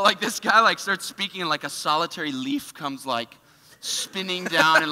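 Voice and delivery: ordinary talk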